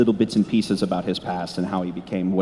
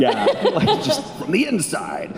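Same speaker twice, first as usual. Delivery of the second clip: high, giggling through the words; laughing; 13 semitones higher than usual. Real, not acted. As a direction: laugh while you finish the thought. Laughing at own joke.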